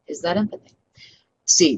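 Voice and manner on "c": normal talking voice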